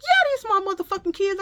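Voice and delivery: fast, high, loud comeback; retold angry retort. Mother answering yet another nosy shopper, retold angrily. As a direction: fire back fast, acting out outrage.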